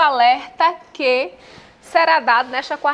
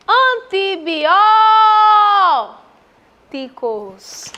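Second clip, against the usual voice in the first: slow, loud, high call holding the "ó" in "Antibióticos"; stressed syllable called out; 5 semitones higher, 6 dB louder, much slower than usual. Shown as a demonstration. Teacher calls out "Antibióticos" to show its stress, long-held "ó". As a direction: shout "Antibióticos" with a very long "bió".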